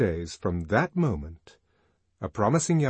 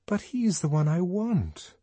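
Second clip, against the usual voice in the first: much higher pleading voice; plaintive insistence; 10 semitones higher than usual. A narrator's reading. Lenina insisting to Fanny on one man, plaintive. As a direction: plead your case in a raised pitch.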